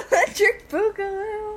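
loud, high, laughing; cracking up mid-title. Real, not acted. Echoes joke laughing.

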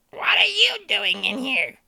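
put-on high, slow, snotty baby voice. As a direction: challenge him like a bratty baby. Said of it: "the baby's like".

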